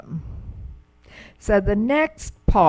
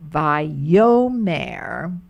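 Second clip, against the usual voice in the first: Vayomer repeated with the accent on yo, a bit louder; vay-YO-mer once more, hitting yo; stress on yo; 4 dB louder than usual. Shown as a demonstration. Teacher repeating for Hebrew class, yo stressed. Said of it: "they put the accent on the yo".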